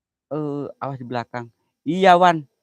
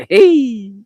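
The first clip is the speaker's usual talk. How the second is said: sudden shout; 13 semitones higher, 13 dB louder than usual. Real, not acted. Sudden exclamation after poem.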